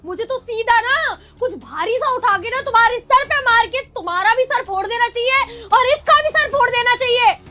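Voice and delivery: loud furious threat; angry shouting. Acted. Wife screaming threats at cheating husband, furious. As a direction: rage at him with every word. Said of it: "इतना चीखने की जरूरत नहीं है".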